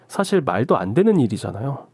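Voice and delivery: plain reading voice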